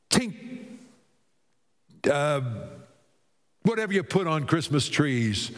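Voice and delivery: ordinary delivery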